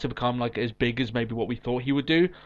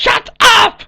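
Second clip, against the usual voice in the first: high, loud comic yell; shouted shut up; 14 dB louder than usual. Acted. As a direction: bellow it at the top of your voice. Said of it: "I challenge you to a shut up off".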